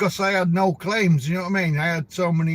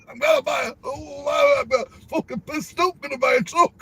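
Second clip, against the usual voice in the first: mock drunk; 8 semitones higher than usual. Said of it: "mocking the truck owner".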